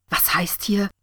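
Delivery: acted indignant challenge; ranting. A narrator's reading. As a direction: challenge your friend, mildly outraged. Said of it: "wetterte sie".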